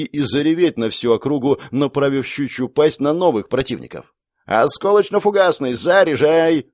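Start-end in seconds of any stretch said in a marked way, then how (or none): 4.5-6.7 громкий выкрик, выше и громче; командуй артиллеристам во весь голос; крик команды. Acted. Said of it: "закричал генерал". (генерал кричит артиллеристам, громкий приказ)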